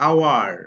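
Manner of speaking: আওয়ার drawn out as aa-war, no h; pronunciation demo. Shown as a demonstration. Teacher showing the silent h in আওয়ার. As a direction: stretch আওয়ার slowly with a silent h. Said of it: "এইচ এর উচ্চারণ কিন্তু হ হয় না".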